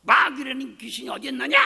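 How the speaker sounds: loud scoffing shout; unbelieving father shouting at his son; angry father's shout. Retold or imitated. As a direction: shout him down in disbelief. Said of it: "막 그러세요".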